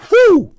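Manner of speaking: very high, loud whoop; excited whew. Real, not acted. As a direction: punch out a high "whew" of amazement. Amazed at the stock's growth.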